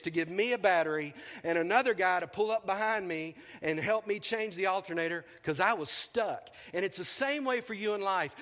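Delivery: calm, slowing pastor's appeal; sermon application. Acted. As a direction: press the lesson home gently. Preaches the lesson.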